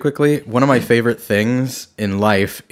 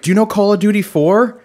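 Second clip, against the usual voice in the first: put-on higher, louder eager kid; 8 semitones higher, 4 dB louder than usual. Voicing the kid asking about games, eager. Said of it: "And the kid was like".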